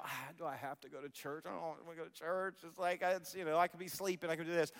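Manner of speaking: low, soft, grumbling mock complaint; lightly voiced grudging whine; reluctant churchgoer whine. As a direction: whine like you'd rather be sleeping. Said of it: "sometimes you go".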